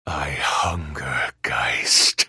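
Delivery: slow, low hissing demon whisper; a trapped hunger breathing out; demon's whisper. Acted. Oathkeeper whispering his hunger to Oma, low and slow. Demon speaking from her arm.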